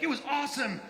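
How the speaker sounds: high, excited exclaiming; high-pitched boyish thrill; thrilled boys. Retold or imitated. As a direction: imitate teenagers bursting with the news. Boys report the kill excitedly.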